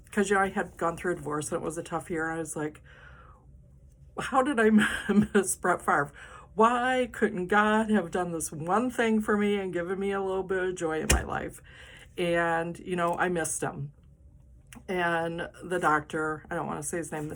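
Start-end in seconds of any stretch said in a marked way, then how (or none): 4.2-11.6 mock dramatic lament. Telling her doctor about missing Brett Favre, mock dramatic wail. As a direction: ham up the tragedy of missing him. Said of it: "I was so dramatic".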